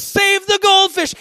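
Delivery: high, loud, drawn-out cry to the crowd; mock urgent yell. Youth pastor sending the crowd after goldfish, urgent yell. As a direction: yell it like an emergency.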